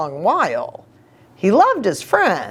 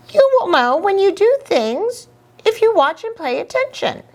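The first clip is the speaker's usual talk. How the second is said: high, fast monkey chatter; 13 semitones higher, faster than usual. Acted. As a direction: play the cheeky monkey. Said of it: "Gogol, the monkey ... said".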